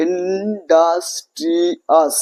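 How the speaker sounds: ইন্ডাস্ট্রিয়াস drawn out as in-das-tri-as; syllable by syllable. Shown as a demonstration. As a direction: slowly separate every syllable of ইন্ডাস্ট্রিয়াস. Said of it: "এতবার যে syllable গুলো আমরা ভেঙে পড়ছি".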